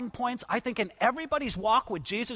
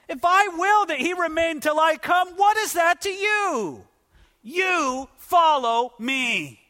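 forceful rebuke; 6 semitones higher, slower than usual. Acted. Jesus answering Peter about John, forceful rebuke. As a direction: press the question, then land it slowly.

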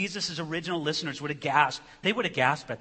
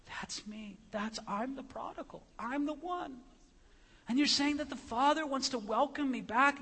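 moved listener, acted; 7 semitones higher than usual. Sinner realising the Father welcomes him, acted tearful wonder. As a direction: start hushed, grow higher and amazed. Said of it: "they're just weeping".

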